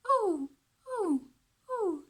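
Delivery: high loud hooting "ooh"; owl hoot. Shown as a demonstration. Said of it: "that says".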